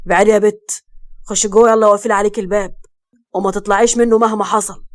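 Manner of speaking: loud, urgent command; angry yelling. A narrator's reading. Arrested husband ordering his wife indoors, angry yelling. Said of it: "وقال وهو بيزعق".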